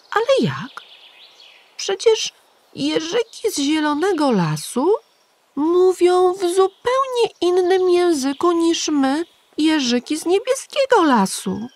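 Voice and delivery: put-on a small boy insisting it can't work; protesting little hedgehog. A narrator's reading. Igiełka protesting to the pigeon, high and insistent. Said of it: "Zaprotestował Igiełka".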